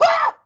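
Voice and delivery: very high, loud yelp; startled yell. A narrator's reading. Man jumping at the eye in his mug, startled yelp. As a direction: yelp as if jolted by a fright.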